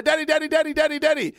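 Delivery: put-on high, insistent child calling daddy over and over; little boy calling. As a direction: mimic a small son pestering his dad for attention. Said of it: "Teo's like".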